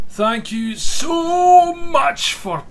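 high, drawn-out mock gratitude; sarcastic thanks. Player thanks someone for predicting their death, sarcastic gush.

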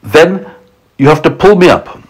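high, emphatic instructions; crying out. A narrator's reading. James telling friends to haul him up, crying out. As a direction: call out each step clearly. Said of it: "cried James".

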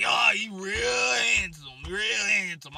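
slow, loud compliment; loud flirting. Retold or imitated. Toothless lady flirting with him, loud and slow. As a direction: call out the compliment boldly. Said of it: "she's like".

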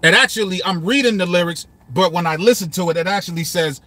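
usual speaking voice

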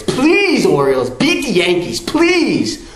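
high, cracking pleas of please; desperate begging. Real, not acted. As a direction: beg like your season depends on it. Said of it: "I beg you".

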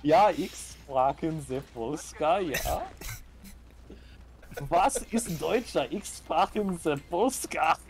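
slow, mock German-Polish accent; fake Polish accent. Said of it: "That's my, uh, Polish accent".

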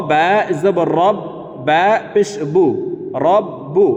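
রব্বু with the b held double; doubled ba. Shown as a demonstration. Teacher spells out রব্বু for learners, b pronounced twice. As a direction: close রব on b, then release বু. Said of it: "এই বা হরফটি দুইবার উচ্চারণ করে পড়ব".

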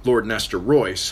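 usual speaking voice

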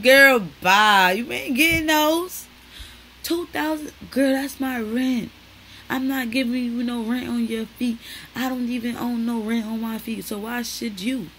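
loud, high dismissive mother; mother refusing. Retold or imitated. Mom refusing her daughter's pricey shoes, loud and dismissive. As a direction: bark it like a mom shutting it down. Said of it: "She said".

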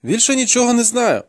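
put-on high-pitched frightened captive boy; protesting boy, pitched right up; insistent youth. A narrator's reading. Captive boy under interrogation.

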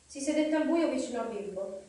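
ordinary delivery, nothing marked

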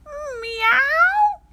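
high, loud alarmed meow; warning meow. Acted. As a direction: yowl a warning like a cat. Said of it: "in my watch-out voice".

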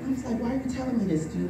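normal talking voice

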